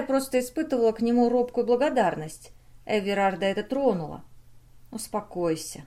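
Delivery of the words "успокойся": calm, softer reassurance; gently, to settle her; soft repeat. A narrator's reading. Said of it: "повторил он".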